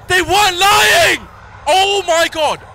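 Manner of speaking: high slow panicked shout. Real, not acted. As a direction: yell it out in disbelief. Realizing friends were right.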